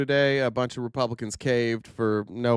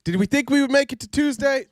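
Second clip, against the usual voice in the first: excited; 10 semitones higher, 7 dB louder than usual. Real, not acted. Excited show opener.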